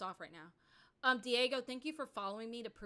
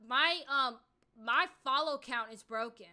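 annoyed complaint; 3 semitones higher, 8 dB louder than usual. Real, not acted. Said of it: "Like, I'm really pissed off right now".

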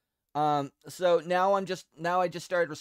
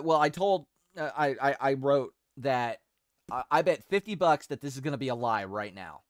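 ordinary talk, nothing marked

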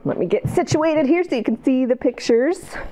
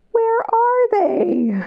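wondering aloud; 13 semitones higher, much slower than usual. Acted. Searching, suspense for kids.

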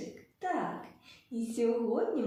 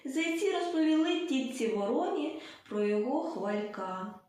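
ordinary delivery

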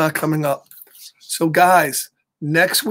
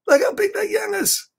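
amazed. Real, not acted. Amazed at a picture in the book.

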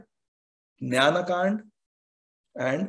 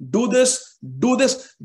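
"do this" punched higher and louder, "just do this" drawn out slowly; emphatic insistence; 5 semitones higher, 4 dB louder, much slower than usual. Shown as a demonstration. Teacher showing students what emphasis sounds like, insistent. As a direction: hammer home "do this" three times, the last one slow. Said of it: "Means emphasis".

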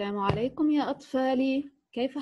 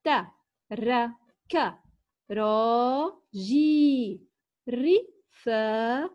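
very slow, ترك راجي رفاقي each drawn out with pauses; word by word; much slower than usual. Shown as a demonstration. Teacher reading aloud to children, very slow and word by word. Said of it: "لنقرأ معًا هذه الجمل".

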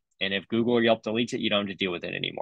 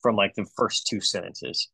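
ordinary delivery

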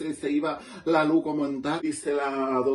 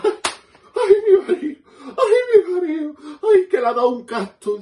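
very high, loud, laughing wail to the doctor; a wife shrieking to the doctor about her husband, hammed up; panicked wailing; 8 dB louder than usual. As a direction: wail ay mi marido in mock panic, much higher and louder. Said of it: "le digo a la doctora".

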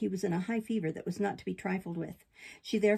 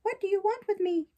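high, alarmed puppet voice; frightened cry; 12 semitones higher, 4 dB louder than usual. A narrator's reading. Puppet confronting the rabbits with the bier, frightened. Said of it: "in a great fright".

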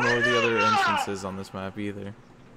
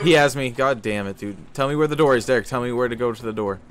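scared horror game streamer, shouting; panicked yelling; 2 semitones higher, 9 dB louder than usual. Real, not acted. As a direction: yell desperately for directions. Caught by monster, yelling.

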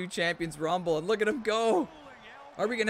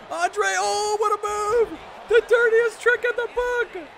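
much higher and louder amazed yell; excited outcry; 13 semitones higher, 6 dB louder than usual. Real, not acted. Streamer yelling at a dirty trick in the match, high and loud. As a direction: shout it out in disbelief. Reacting to a dirty move live.

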